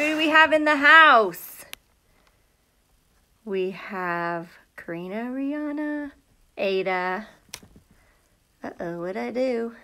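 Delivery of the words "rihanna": ordinary delivery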